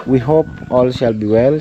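ordinary delivery

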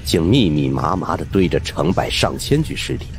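ordinary delivery, nothing marked

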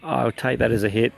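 normal talking voice

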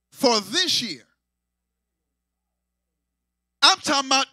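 loud, ringing sermon climax; preaching at full force. Acted. Preacher shouting to the congregation at full force.

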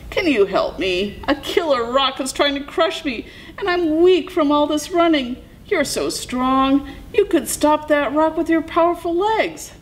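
high, hurried pleading coyote; Coyote calling out. A narrator's reading. Coyote begs Bighorn Sheep to stop the rock, calling out. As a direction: plead high to a possible rescuer. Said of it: "he called out".

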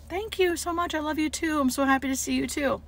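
high, soft, sweet reply; tender thanks. Retold or imitated. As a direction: answer the patient with gentle affection. Nurse tenderly answering the patient.

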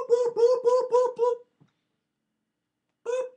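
very high, laughing "boop, boop" chain; giggly boops. Pretend. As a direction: bounce out squeaky boops through a giggle.

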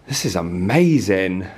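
ordinary talk, nothing marked

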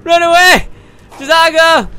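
hammed-up fleeing scream; mock panic. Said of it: "I'm scared".

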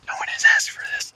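quick, voiceless whisper; whispered aside. Acted. Announcer undercuts his own hype, quick whispered aside.

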